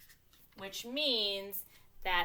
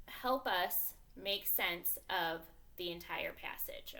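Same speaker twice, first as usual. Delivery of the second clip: ordinary talk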